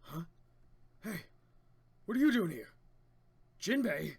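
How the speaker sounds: loud high challenge; startled shout. Acted. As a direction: demand it in alarm. Voices startled crew member.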